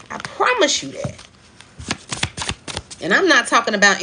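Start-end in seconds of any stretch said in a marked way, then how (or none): 0.1-1.1 much higher, pushed up into the top of the range; a sudden high-pitched promise; high emphatic repeat. Shown as a demonstration.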